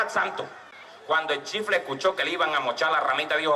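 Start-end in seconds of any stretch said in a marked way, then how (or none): none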